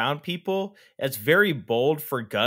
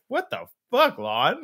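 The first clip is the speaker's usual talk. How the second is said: high-pitched baffled protest; mock disbelief; 6 semitones higher than usual. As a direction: act appalled, jumping up in pitch. Acted shocked reaction to Lon.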